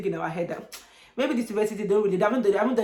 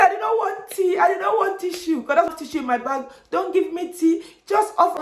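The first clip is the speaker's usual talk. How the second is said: loud, high mock bawling; desperate, high-pitched retold plea; imitated crying; 11 semitones higher, 5 dB louder than usual. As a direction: wail it like a hammed-up tantrum of tears. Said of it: "I started crying".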